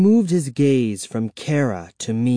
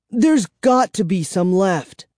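put-on high, urgent child's voice; pleading cry; 8 semitones higher, 3 dB louder than usual. A narrator's reading. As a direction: cry it out with desperate hope. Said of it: "I cried".

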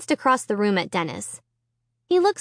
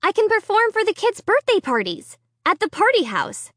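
high, eager chatter; bubbling, pitched high; excited; 10 semitones higher than usual. Acted. Girl excited on phone.

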